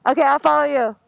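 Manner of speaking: loud, high, laughing through it. Real, not acted. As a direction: call it over road noise. Shouting over the helmet intercom.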